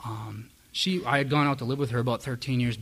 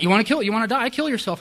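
blunt mom; 9 semitones higher, 6 dB louder than usual. Retold or imitated. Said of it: "She's like".

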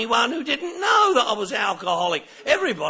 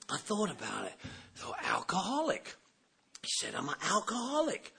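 quiet dawning; 3 semitones lower, 12 dB quieter than usual. Retold or imitated. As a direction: let it sink in quietly. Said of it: "quiet dawning realization".

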